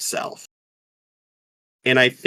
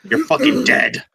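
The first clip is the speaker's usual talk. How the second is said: very high, hammed-up threat; playful fake menace; mock threat; 4 dB louder than usual. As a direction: blurt a joke death threat, pitched way up. Joke reading of the note.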